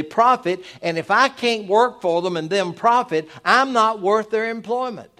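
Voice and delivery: loud, high-pitched sermon delivery; full-force preaching. Real, not acted.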